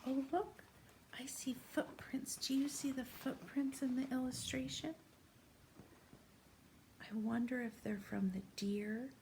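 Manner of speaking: soft, unvoiced whisper to a child; hushed whisper. Teacher whispers to a young child over a snowy picture. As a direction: whisper as if not to wake anything.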